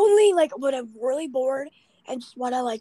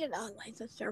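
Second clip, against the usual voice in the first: whisper; 3 semitones lower, 15 dB quieter than usual. Real, not acted. Playful aside about online sister.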